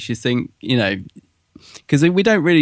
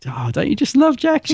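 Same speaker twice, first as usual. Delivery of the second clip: excited; 12 semitones higher than usual. Real, not acted. Gushing about a favourite character.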